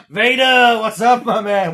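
high, loud mock caller; chirpy buddy on the phone. Friend calling Vader on the phone, mock cheerful. Caller voiced in Robot Chicken retell.

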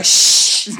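sharp unvoiced shush. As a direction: hush him sharply. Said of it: "his brother go".

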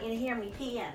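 distant voice from the next room; talking far off the mic; from another room. Real, not acted. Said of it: "I'm gonna keep talking from the other room".

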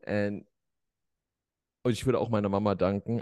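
usual speaking voice

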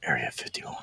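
secret whisper. Pretend. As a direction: whisper it conspiratorially. Joking whispered aside.

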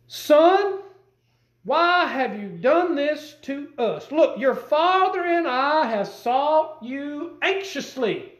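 high, slow, pleading mother's voice; worried mother. Retold or imitated. Preacher voicing Mary scolding her lost son, anxious and slow. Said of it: "a motherly talk to her son".

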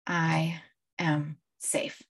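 quiet, very slow mantra; calm affirmation. Shown as a demonstration. Coach repeating an affirmation against fear, calm and soft. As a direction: space the words out softly, reassuring yourself.

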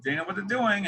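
mock sarcasm, pitched high; lightly sarcastic, high-pitched retelling. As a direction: imitate professors talking snidely about their department. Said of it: "say that in a sense of a very sarcastic way".

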